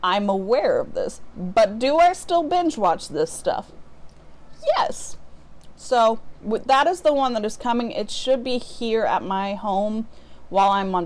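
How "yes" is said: sudden very high, guilty-gleeful yes; squeaky yes. Real, not acted. Admitting she binges reality TV, a very high squeaky yes. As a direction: pop the yes up high like a confession. Answering her own question.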